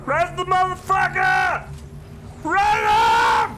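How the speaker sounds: loud, drawn-out yell at a teammate; urgent shout. Real, not acted. Yelling for a teammate to revive, loud urgent shout. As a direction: bellow the order across the fight.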